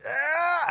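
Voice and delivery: high mock yell of pain; imitated scream. Retelling the claw scene, a mock scream. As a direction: cry out like the claws tear through. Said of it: "It's like".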